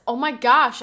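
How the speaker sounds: usual speaking voice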